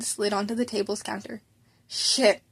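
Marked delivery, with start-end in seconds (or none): none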